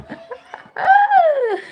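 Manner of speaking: a bright burst of real laughter, high and falling; quick high giggle that falls away. Real, not acted. Streamer laughs at chat.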